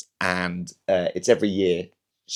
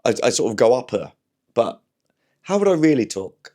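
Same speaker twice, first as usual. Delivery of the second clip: normal talking voice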